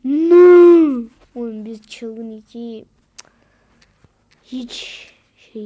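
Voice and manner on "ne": very loud, higher shouts; furious shout. Real, not acted. Gamer screaming during final fight. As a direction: scream at the enemy.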